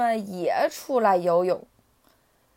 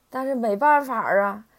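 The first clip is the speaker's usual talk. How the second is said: plain reading voice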